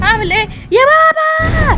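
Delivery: slow, high panicked cry to dad; panicked shout. Acted. Daughter shouts to her growing father in panic.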